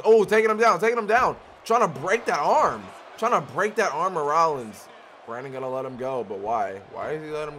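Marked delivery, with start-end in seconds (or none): 0.1-4.9 excited commentary. Real, not acted. Armbar in the match.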